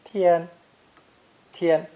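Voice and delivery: "Tian" held high and level; high flat first tone. Shown as a demonstration. Teacher demonstrating the first tone to learners, "Tian" high and flat. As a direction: say "Tian" on a high, even pitch without any fall. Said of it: "pronounced with a high and flat first tone".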